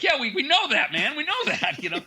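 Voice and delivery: very high-pitched, mock exasperation; exasperated retort. Band answering the CD presser's obvious remark, comic exasperation. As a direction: insist in a squeaky, worked-up voice. Exasperated reply to CD maker, retold.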